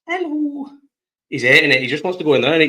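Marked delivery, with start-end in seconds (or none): 0.1-0.7 put-on much higher, soft baby-talk hello to the puppy; greeting the new puppy in a much higher doggy voice. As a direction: coo it way up high at the dog. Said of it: "That's my doggy voice".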